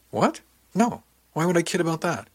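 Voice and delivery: high-pitched, defensive disbelief; incredulous protest. A narrator's reading. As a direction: protest as if absurdly doubted. Protests at being doubted.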